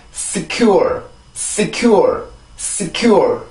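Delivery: secure with a thin hissed S, then stressed falling CURE; English pronunciation. Shown as a demonstration. Teacher drilling learners on the word, careful English pronunciation. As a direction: model secure as s-KYOOR, final r kept. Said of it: "한 번 더".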